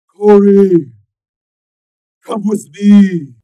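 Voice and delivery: commanding shout. A narrator's reading. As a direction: call her in a booming order. Cyborg calls to the girl.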